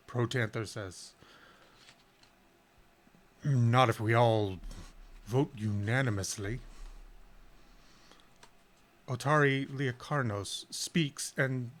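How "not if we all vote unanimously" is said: dragon voice. Acted. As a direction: press the point firmly. Gold dragon retorts.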